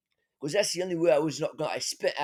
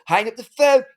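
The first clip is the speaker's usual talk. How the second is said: louder, higher mock command; retold urgent order; 4 semitones higher, 8 dB louder than usual. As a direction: call out a sudden order, re-enacted.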